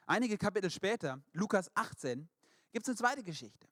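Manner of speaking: normal talking voice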